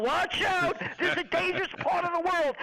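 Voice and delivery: high, hurried mock alarmist newscaster; mock news alarm. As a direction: ham up the breathless media panic. Mocking news hype about missiles.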